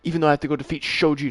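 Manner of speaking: loud, exasperated yell at the game; frustrated yell. Real, not acted. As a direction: shout it out in frustration.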